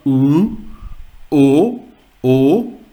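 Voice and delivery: O as a loud, isolated umlaut vowel, repeated; O umlaut sound. Shown as a demonstration. Teacher sounding out O umlaut, loud isolated vowels. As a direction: sound out U, O, O firmly, one by one. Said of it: "O umlaut".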